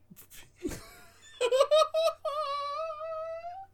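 high, loud laughing fit; hysterical laughter. Real, not acted. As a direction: laugh until it hurts. Big laugh after the bit.

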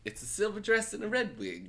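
high put-on voice; performed high-pitched catchphrase; catchphrase impression. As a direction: quote it up high, in character. Correcting the quote in character.

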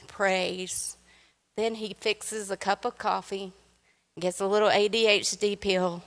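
usual speaking voice

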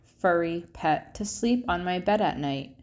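plain reading voice

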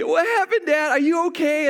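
very high imitated child's voice, alarmed; scared daughter. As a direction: worriedly check on Dad, like a frightened kid. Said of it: "scared now".

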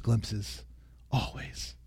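trailing whisper. Acted. Preacher closing the point in a fading whisper. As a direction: let the word fade to a breath.

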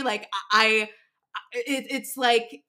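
halting, tripping over I again and again; words jamming up as nerves return; stuttering. Real, not acted. Guest recalling driving [name], real stuttering. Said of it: "you hear me stuttering?".

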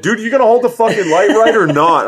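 high, loud mock yell of a fed-up father; mock yelling at son. Father imitating himself yelling at his son, high mock yell. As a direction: snap at the kid holding the light.

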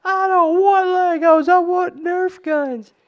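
put-on high, loud, slow toddler wail; whiny spoiled pouty drawl. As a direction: whine it like a spoiled kid in a tantrum. Child's meltdown voiced.

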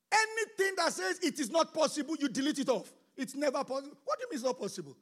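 loud, driving sermon voice; fiery preaching. Acted. Pastor urging the church to violent faith, fiery preaching. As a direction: preach it hard to the congregation.

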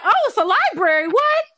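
put-on high and loud, as a giddy, dazzled girl; excited high voice. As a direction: squeal it out like a star-struck fan in a library. Retold Holly, excited at library.

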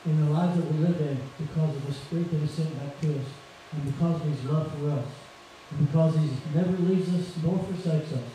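normal talking voice

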